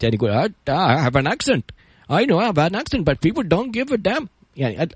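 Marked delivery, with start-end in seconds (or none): 0.7-4.3 put-on higher-pitched Bengali-accented English. Coach quoting a Bengali consultant on his accent, imitated Bengali accent. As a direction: imitate a Bengali accent, drawing out the last phrase. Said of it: "الرجال بنغالي بأكسنت".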